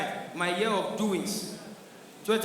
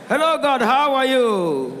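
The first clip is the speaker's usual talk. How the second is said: loud, high, slow eager hello; excited greeting; 4 semitones higher, 7 dB louder, slower than usual. Pretend. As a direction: greet God like an old friend. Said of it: "Igbo man greets God excitedly".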